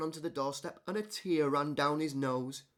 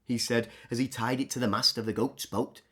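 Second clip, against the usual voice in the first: plain reading voice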